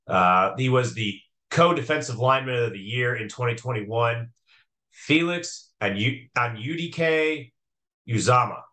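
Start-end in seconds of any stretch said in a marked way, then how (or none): 4.9-8.6 slowly, syllable by syllable; slow, careful name. Real, not acted. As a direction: say the name slowly to get it right. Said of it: "I had to say that slowly to make sure I got it right".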